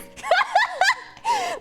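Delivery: loud high cackle, falling off; high burst of laughter. Real, not acted. Laughing at her own joke, a high cackle. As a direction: let a big laugh burst out.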